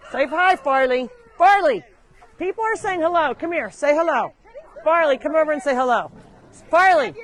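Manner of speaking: loud summons to come over; calling an animal. Real, not acted. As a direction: call out to a pet that isn't listening.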